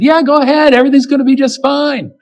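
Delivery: high, fast mock-cheerful sarcasm; sarcastic. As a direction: toss it off as a sneering mock blessing. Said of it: "He did it in a very sarcastic way".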